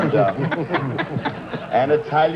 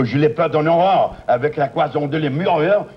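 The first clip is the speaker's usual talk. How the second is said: French accent, rattled off as double talk; mock-fluent French patter; 4 semitones higher than usual. Shown as a demonstration. Comic demonstrating why knowing French hampers double talk, French accent. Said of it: "when you can speak French".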